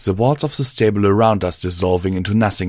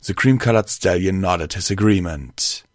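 plain reading voice

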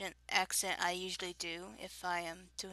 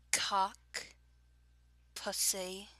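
drawn-out words in a Southern accent; much slower than usual. Shown as a demonstration. Reading a word list for an accent challenge, Southern accent. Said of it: "starting with my usual southern accent".

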